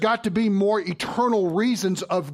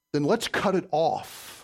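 slow and measured; slower than usual. Acted. Counselor to a struggling man, calm and slow. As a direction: lay it down slowly.

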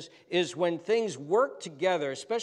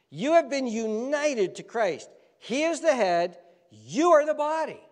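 fervent preaching; 7 semitones higher, 5 dB louder than usual. Acted. Preaching climax.

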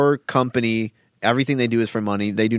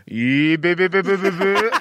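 higher, odd yee-beep squeals; imitating dad's noise; 7 semitones higher, slower than usual. Son retelling the dad on video, high mock beeps. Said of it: "You hear my dad in the background go".

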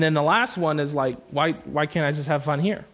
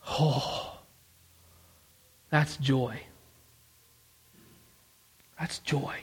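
soft, low, hushed joy; whispered wonder at his son's smile; tender; 6 semitones lower, 7 dB quieter, much slower than usual. Real, not acted. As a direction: let it fall quiet and gentle. Tender moment seeing son smile.

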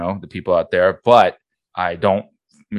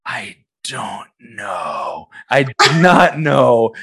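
slow baffled drawl; 3 semitones higher, 4 dB louder, much slower than usual. Retold or imitated. His baffled reply, slowed right down.

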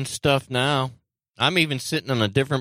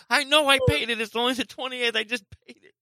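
flustered laughing; 11 semitones higher than usual. Real, not acted. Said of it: "I got scared".

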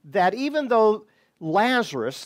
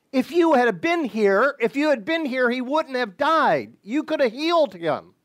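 grieving reproach; 7 semitones higher than usual. Retold or imitated. Martha pleading with Jesus over her dead brother, grieving reproach.

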